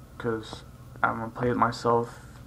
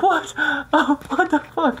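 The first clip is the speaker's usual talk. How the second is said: squeaky, much higher disbelief with a laugh; shocked what; 4 dB louder than usual. Retold or imitated. Reacting to the actor swearing, high and incredulous. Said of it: "I'm like".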